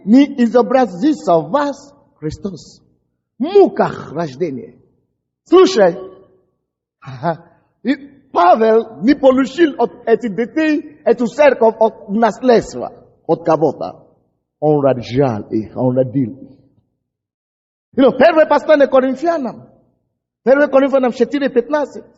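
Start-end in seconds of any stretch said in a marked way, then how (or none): none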